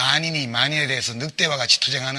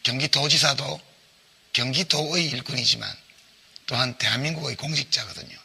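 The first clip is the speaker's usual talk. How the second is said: ordinary talk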